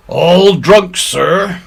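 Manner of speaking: put-on high, loud rough old sea cook calling out; a pirate butting in, cheeky and loud; Silver's pirate voice. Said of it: "struck in the voice of Silver".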